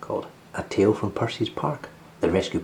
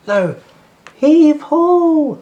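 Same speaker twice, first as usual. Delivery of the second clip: very loud, high heave-ho; heave ho effort call; 9 dB louder, much slower than usual. Acted. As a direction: haul on the rope and call it out. Percy pulling rope.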